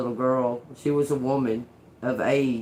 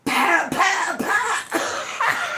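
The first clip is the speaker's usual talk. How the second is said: mock punch sounds; much slower than usual. Imitating her punches.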